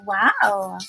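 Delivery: ordinary delivery, nothing marked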